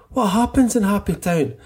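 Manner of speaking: high, playful chant question; high call-and-response cue; dad's routine question. Retold or imitated. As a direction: pitch it right up like prompting a child. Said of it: "he's just like".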